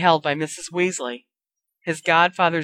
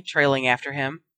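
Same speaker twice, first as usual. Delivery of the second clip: usual speaking voice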